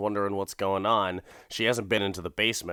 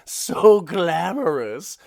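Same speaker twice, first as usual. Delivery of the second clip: high, slow, drawn-out campy purr; hammed-up glamorous gloat; campy villain; 8 semitones higher, 4 dB louder, much slower than usual. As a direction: savor it like a diva villain.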